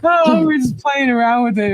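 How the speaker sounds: ordinary talk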